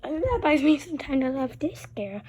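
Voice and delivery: soft secret whisper. Real, not acted. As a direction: whisper so he won't hear. Hiding from puppy, whispering.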